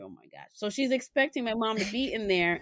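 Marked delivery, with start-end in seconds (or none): 0.0-0.5 very soft, deflated oh my gosh; exasperated aside. Retold or imitated. Mom hears the kids expect grandma in the chair, soft exasperated mutter. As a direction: sigh it out under your breath, defeated. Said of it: "I'm like".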